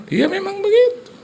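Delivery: very high, loud protest; imitated wife. Wife defends the empty kitchen, high mock squeal.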